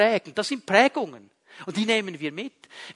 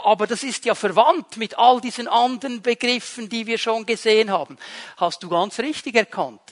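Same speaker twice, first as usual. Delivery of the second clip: ordinary talk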